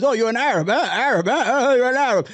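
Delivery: lightly mimicked taunting heckler; mocking imitation. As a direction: repeat it as a mocking jab, pitch lifting at the end. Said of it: "he goes".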